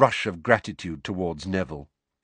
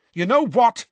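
high, loud heated challenge; heatedly; 12 semitones higher, 6 dB louder than usual. A narrator's reading. Seamus turning on Ron, high and heated. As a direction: spit it out hot. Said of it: "said Seamus heatedly".

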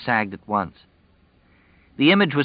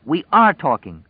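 sharp, louder retort; flashing back; 8 semitones higher, 6 dB louder than usual. A narrator's reading. Fran snapping back at Jess, angry. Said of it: "she flashed at him".

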